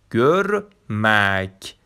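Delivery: گرمک drawn out very slowly with rounded ö; slow Turkish word. Shown as a demonstration. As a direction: stretch گرمک out like a teacher. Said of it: "گرمک یعنی دیدن".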